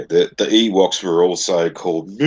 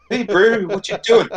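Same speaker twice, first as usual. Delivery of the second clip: higher-pitched mock Kiwi bloke greeting; chirpy put-on Kiwi accent, louder; New Zealand accent; 13 semitones higher, 4 dB louder than usual. Mocking how New Zealanders talk, higher Kiwi accent. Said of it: "you hear the New Zealanders like".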